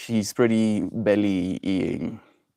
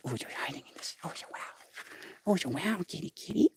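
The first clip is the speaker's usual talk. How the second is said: whispered, much softer coo; whispering to a shy cat; 8 semitones higher, 12 dB quieter, faster than usual. Real, not acted. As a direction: hush and coo to the hiding cat.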